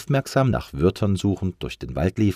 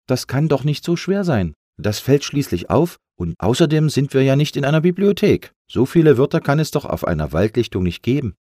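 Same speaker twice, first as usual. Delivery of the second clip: high, lively pep talk; rally your friend, full of eagerness; enthusiastic; 8 semitones higher, 4 dB louder than usual. A narrator's reading. Lufti excited by his clever idea, enthusiastic. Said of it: "Lufti war ganz begeistert".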